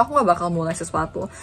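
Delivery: normal talking voice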